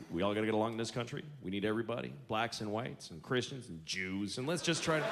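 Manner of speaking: a hint of a president giving a speech; the president slipping one in mid-speech; mock president. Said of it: "I wish the president would slip one".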